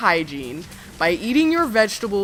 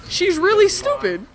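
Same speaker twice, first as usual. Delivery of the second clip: higher-pitched, imitating a baffled onlooker; incredulous retold quote; mock disbelief; 10 semitones higher, 3 dB louder than usual. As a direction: play the one doubter in the room, pitched up. Voices the one baffled dissenter.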